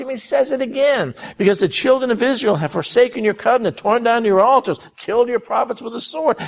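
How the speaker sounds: a lightly hammed-up pitiful whine; whiny Elijah. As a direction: whine like a man sorry for himself. Preacher voices Elijah complaining.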